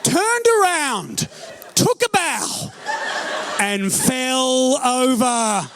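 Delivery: high, drawn-out laughing punchline; slow comic punchline. Real, not acted. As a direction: drag out the punchline. Punchline delivery.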